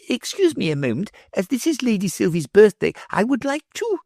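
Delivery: put-on high, fast fussy old man; hurried professor. A narrator's reading. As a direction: rush off apologizing. Professor voiced by reader.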